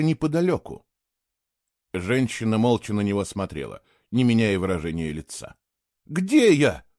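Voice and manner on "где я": high, scared quivering question; frightened boy asking where he is; trembling voice. Acted. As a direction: ask it still shaking. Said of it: "всё ещё дрожащим голосом".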